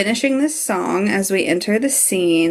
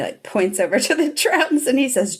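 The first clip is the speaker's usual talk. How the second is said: usual speaking voice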